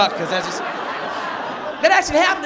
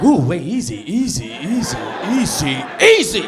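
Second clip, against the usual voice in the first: mock startled woman; 5 semitones lower, 3 dB louder, slower than usual. Woman's reaction voiced.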